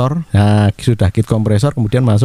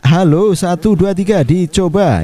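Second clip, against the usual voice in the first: shouting; 10 semitones higher than usual. Shown as a demonstration. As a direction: yell the count into the mic. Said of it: "Kita berteriak".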